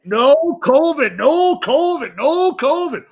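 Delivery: high, drawn-out repeated refrain; mock insistence. Mocking the town's covid-free boast, high drawn-out insistence. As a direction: stretch each one out high, insistently.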